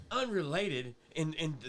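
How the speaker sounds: ordinary delivery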